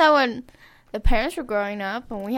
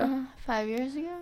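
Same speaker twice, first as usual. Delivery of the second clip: whispering; 4 semitones higher, 9 dB quieter than usual. Real, not acted. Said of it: "Why are we whispering?".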